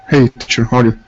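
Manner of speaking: normal talking voice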